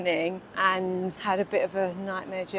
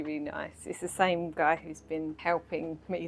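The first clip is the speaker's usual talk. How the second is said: ordinary delivery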